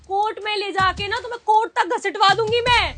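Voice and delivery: loud furious rant; angry shouting. Acted. Wife raging at husband, threatening court, loud and angry. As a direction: scream it out, beside herself.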